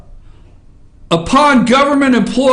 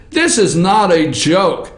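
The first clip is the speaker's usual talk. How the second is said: much lower, earnest and measured; serious, toned down; 8 semitones lower than usual. Real, not acted. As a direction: bring it down and mean every word. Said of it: "let me try to ratchet this down and get serious".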